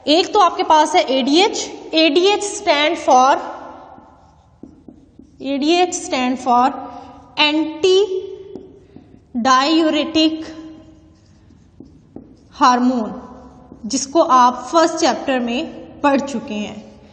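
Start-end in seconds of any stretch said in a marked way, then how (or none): 1.9-3.4 slow dictation. Shown as a demonstration. Teacher dictates expansion. 5.4-13.2 slow dictation. Shown as a demonstration. Teacher repeats, dictating slowly.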